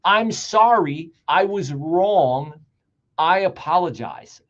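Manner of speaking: each phrase slow and deliberate, heavy pauses; slow apology demo. Shown as a demonstration. Host shows viewers how to apologize, slowly. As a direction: spell out a real apology slowly, like teaching it. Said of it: "you actually have to say".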